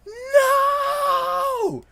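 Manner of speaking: long, very high, loud mock wail; horrified scream. Girl seeing her idol injured, horrified scream. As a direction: scream it out in anguish, drawn out.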